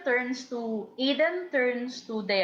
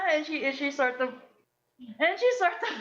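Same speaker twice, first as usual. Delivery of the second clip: usual speaking voice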